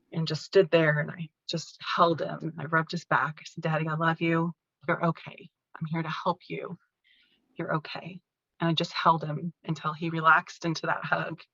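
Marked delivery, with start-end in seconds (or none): 3.6-8.2 soft, soothing murmur, growing quieter; tender reassurance. Retold or imitated. Daughter holding her dad and rubbing his back, softly reassuring. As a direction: comfort a frightened parent softly.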